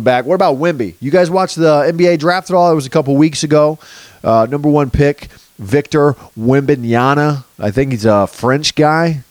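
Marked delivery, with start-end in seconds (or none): none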